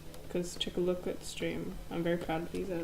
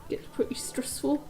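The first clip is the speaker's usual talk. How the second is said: put-on high, breathy New Zealand accent; 8 semitones higher than usual. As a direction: go high and Kiwi. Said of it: "in a New Zealand accent".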